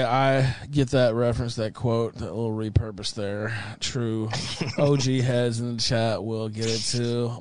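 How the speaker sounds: ordinary talk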